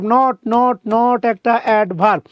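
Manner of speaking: ordinary talk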